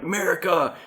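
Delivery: high-pitched imitation of a rallying cry; mock patriotic cheer. Imitating someone rallying a subway car, mock patriotic cheer. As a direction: cheer it without full volume.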